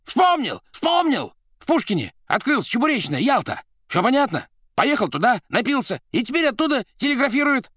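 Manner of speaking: very high, loud excited outburst; startling shout. A narrator's reading. Administrator remembering the Yalta eatery, shouting excitedly. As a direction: yell it as a sudden realisation. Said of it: "закричал так, что Римский вздрогнул".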